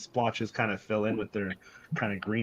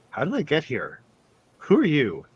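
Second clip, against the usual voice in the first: startled, higher-pitched questions; bewildered goblin; 5 semitones higher, 4 dB louder than usual. Acted. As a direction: ask it in sudden confusion. Game master voices bewildered goblin.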